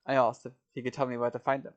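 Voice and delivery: ordinary delivery, nothing marked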